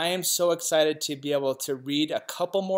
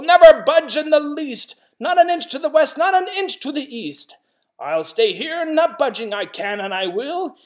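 loud, higher, unbudging yell; stubborn yelling; 7 semitones higher, 8 dB louder than usual. Acted. South-going Zax yells his rule at the north-going one, loud and high. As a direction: shout your rule and never budge. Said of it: "yelled the south-going Zax".

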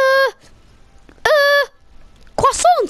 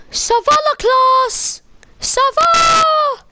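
mock French greeting; 7 semitones higher, slower than usual. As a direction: call ça va to the class. Teacher greets class loudly.

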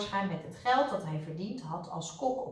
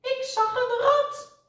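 slow, high, loud whine; high-pitched fearful complaint; frightened wail; 6 dB louder, slower than usual. A narrator's reading. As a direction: draw it out in a scared wail. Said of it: "klaagde Suzy".

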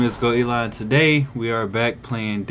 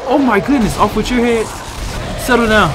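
loud, higher rapid exclamations; frantic yelling; 10 semitones higher, 7 dB louder than usual. Real, not acted. Gamer fighting off a big creature, yelling frantically. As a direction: keep shouting through the fight.

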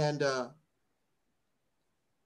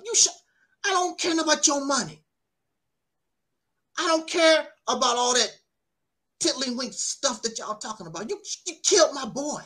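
angry outburst; 6 semitones higher than usual. Real, not acted. Furious at parents forgiving.

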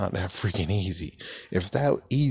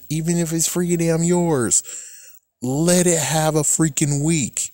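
higher-pitched, exasperated appeal; exasperated protest; 7 semitones higher than usual. Retold or imitated. Creator protesting to the clip's owners, exasperated. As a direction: argue back with a raised pitch.

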